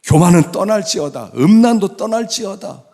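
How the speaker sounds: high, loud repeated commands; ringing rebuke; forceful proclamation. Shown as a demonstration. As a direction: declare it with preacher's authority. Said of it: "계속 선포하는 거예요".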